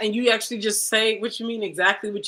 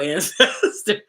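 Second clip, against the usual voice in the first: usual speaking voice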